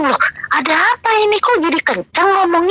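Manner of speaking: startled, pitch jumping up; taken aback. Retold or imitated. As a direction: act out being caught off guard by someone's sudden outburst. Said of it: "saya kaget dong".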